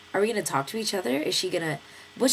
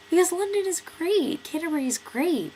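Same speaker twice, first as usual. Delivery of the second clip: high, emphatic sarcastic retort; talking back to locals about Dover, mockingly enthusiastic; mock enthusiasm; 11 semitones higher, 3 dB louder than usual. Answering Dover locals who ask why she came, high mock-bright praise.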